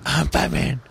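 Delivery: hammed-up hero rasp; Batman. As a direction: announce it as a mock gruff superhero. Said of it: "I'm going to talk like this".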